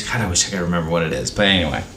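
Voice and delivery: ordinary delivery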